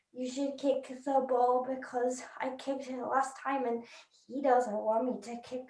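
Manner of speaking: very soft, hesitant deadpan; quiet uncertainty. Acted. Players in the middle unsure about kicking, hushed hesitation. Confused players saying their doubts.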